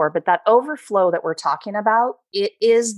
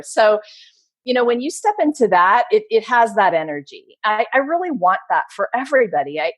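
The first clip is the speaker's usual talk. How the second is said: usual speaking voice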